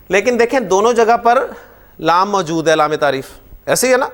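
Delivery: usual speaking voice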